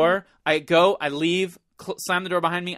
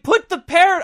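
high, loud inner panic; screaming it in his head; frantic inner plea; 13 semitones higher, 8 dB louder than usual. Retold or imitated. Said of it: "I'm not saying this, but I'm thinking".

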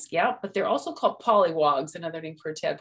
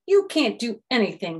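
frustrated frog; 6 semitones higher, 5 dB louder than usual. Acted. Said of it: "he said".

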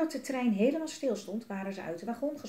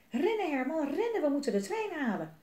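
higher, urgent calling out; 8 semitones higher than usual. Acted. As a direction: urge the dragon to run. Kinderen rennen de trein achterna. (de tweeling roept Herman hoog en gehaast toe)

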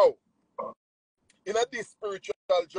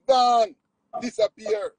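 ordinary talk

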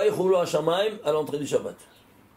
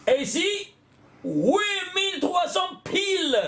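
excited reveal; 13 semitones higher than usual. Real, not acted. Teacher to his students, revealing the exact total in a high triumphant shout. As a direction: cry out the number like a discovery.

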